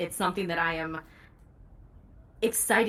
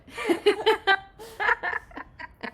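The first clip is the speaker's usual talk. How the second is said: high-pitched burst of real laughter; long, high laugh; 13 semitones higher, 3 dB louder than usual. Real, not acted. Joking to interviewer about attempting Australian, high real laugh. Laughs at own hedged answer.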